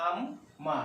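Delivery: "আম্মা" split as am, pause, ma; slowly part "আম্মা" into am and ma, sounding m twice; doubled mim. Shown as a demonstration. Teacher showing learners tashdid on mim, doubled mim. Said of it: "মিমটাকে দুবার উচ্চারণ করতে চাই".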